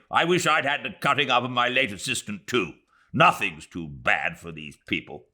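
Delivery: quick spiteful aside; a hint of vicious major. Major Callender's parting sneer, lightly spiteful. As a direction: add it with venom.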